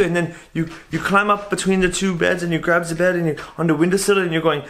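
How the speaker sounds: put-on accented roommate voice rising in agitation; agitated foreign roommate. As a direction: imitate a flustered bunkmate recounting chaos. Roommate retelling the chaos, agitated.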